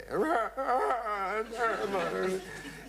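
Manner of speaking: high, drawn-out mock sobbing; hammed-up weeping wail; imitated wailing. Lady arriving at the funeral, mock crying. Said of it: "and she was crying".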